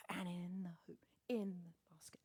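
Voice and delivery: soft breathy commentary whisper; mock golf commentary whisper; whispered aside.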